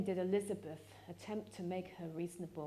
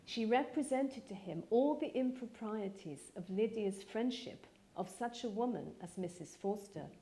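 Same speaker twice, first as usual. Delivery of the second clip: usual speaking voice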